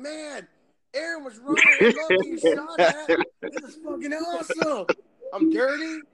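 high, laughing, sarcastic mock cheer; mock gung-ho recruit. Mocking the cousins' imagined delight in the dirt, high and laughing. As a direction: play a grunt pretending mud is fun, overdone. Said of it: "in the dirt going".

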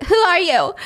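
high, startled question; confused. Retold or imitated. Yeti to an unknown woman, high confused question. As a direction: blurt it out, baffled. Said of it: "Yeti is confused".

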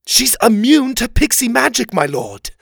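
high, loud rebuttal; urgent protest. A narrator's reading. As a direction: insist urgently against the king. Oliver argues back in quarrel.

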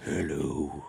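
low, drawn-out hello; deep mock greeting; put-on creepy voice. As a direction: answer in a low, odd character voice. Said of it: "In that voice".

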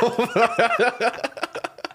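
high extended laugh; real helpless laughter. Real, not acted. Storyteller laughing at himself, helplessly. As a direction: crack up, pitch high.

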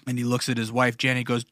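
normal talking voice

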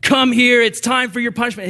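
loud, high, angry summons; harsh stern father. Pretend. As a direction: call them out like a furious judge. Angry God calling for punishment.

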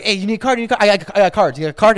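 eager, repetitive showman patter; Sebastian Maniscalco. Retold or imitated. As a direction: imitate a hustling contractor pressing his business card. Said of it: "talks exactly like Sebastian, Sebastian Maniscalco".

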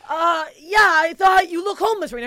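loud doubling down. Retold or imitated. Comic insisting to Bill Burr, high loud insistence.

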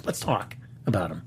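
ひそひそ声で; whispering. Acted. Teasing aside, whispered.

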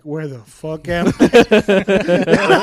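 slow, dazed waking voice; just woken and lost; groggy dad. Retold or imitated.

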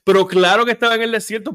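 loud, high, rapid retort; exasperated. Real, not acted. Host mocking the doctor's stoppage, exasperated. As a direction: burst out fast in exasperated disbelief.

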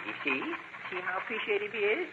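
usual speaking voice

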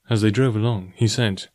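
ordinary delivery

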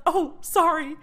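high, flustered "Oh, sorry"; flustered apology. Retold or imitated. Triggered in class, apologizing to the instructor: high flustered sorry. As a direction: apologize on reflex, a bit flustered. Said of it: "I'll be like".

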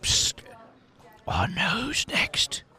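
slow, drawn-out whisper; hushed, slow confiding; conspiratorial whisper. Acted. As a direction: whisper it like a secret tip. Drunk informant confides a secret.

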